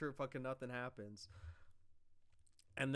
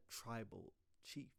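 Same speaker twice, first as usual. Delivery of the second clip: mock sarcastic acknowledgement; 4 semitones lower, 12 dB quieter, slower than usual. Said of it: "he was saying it in a very sarcastic way".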